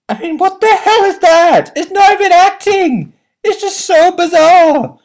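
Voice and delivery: high, loud incredulous outburst; exasperated. Real, not acted. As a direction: let the disbelief burst out. Exasperated rant about the film.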